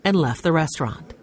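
ordinary delivery

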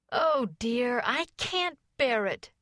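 high, drawn-out wail of complaint; despairing complaint.

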